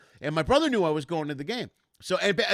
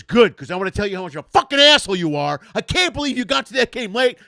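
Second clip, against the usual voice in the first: high, loud angry rant; retold screaming; 5 semitones higher, 12 dB louder than usual. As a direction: scream as the furious brother on the phone. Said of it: "He's screaming at me".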